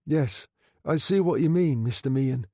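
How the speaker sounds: a hint of a forced smile; uneasy forced smile. A narrator's reading. Nervous undertaker answering his boss with a forced smile. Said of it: "stirred uneasily and forced a smile".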